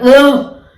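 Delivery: loud, high groan of irritation; exasperated groan. Retold or imitated. Acting a sudden mood swing at people, exasperated groan. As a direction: groan loudly as if fed up.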